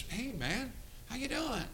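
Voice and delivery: high, soft sing-out hello; retold coaxing greeting. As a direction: gently try to reach someone who stares blankly. Retold greeting to a vacant boy.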